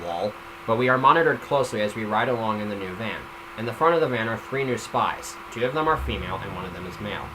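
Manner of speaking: usual speaking voice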